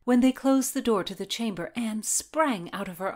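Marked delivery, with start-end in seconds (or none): none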